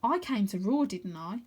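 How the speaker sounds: plain reading voice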